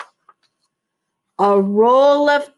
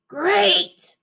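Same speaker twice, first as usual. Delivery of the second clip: barked; 7 semitones higher than usual. Acted. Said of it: "Dog barked".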